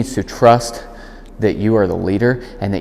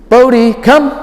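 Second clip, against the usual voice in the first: much louder and higher, firm call; loud clear recall; 8 dB louder, slower than usual. Real, not acted. Trainer calling the dog back, loud and clear. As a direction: call the dog across the room. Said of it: "It's louder than my normal speaking cadence".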